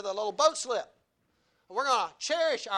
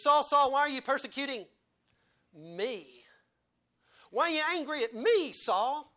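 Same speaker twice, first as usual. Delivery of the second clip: Jesus calling Saul; 6 semitones higher, 5 dB louder than usual. Acted. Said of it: "He says".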